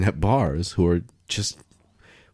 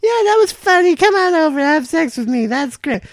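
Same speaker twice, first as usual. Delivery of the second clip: put-on very high, louder squeaky girl voice; mock girl reply; 5 dB louder than usual. [name] answering the pickup line, very high squeaky voice. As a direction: squeal back like a giggly girl. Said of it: "what hookup culture means to me is like".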